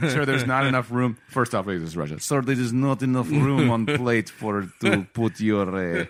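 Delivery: hammed-up Russian clerk accent; mock Russian accent, DMV clerk. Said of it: "stereotypical Russian guy voice".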